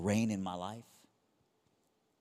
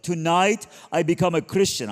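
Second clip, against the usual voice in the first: shouted, much higher and louder; mother screaming; 8 semitones higher, 13 dB louder than usual. Retold or imitated. Retelling his mom screaming her conversion so dad hears. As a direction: belt it out like a mom announcing to the whole house. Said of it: "she was screaming on the phone".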